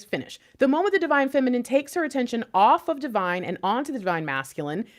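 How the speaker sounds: ordinary delivery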